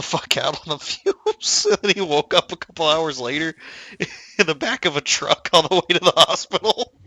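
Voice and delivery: real giggles breaking up the telling; laughing while talking. Real, not acted. Telling friend about the lawnmower fumes mishap, laughing. Laughing through own huffing story.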